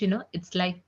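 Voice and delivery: ordinary talk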